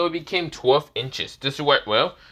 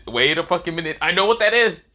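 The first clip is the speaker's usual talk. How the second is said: excited outburst; 4 semitones higher, 5 dB louder than usual. Acted. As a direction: voice rises higher and louder toward the end. Character interrupts in sudden recognition.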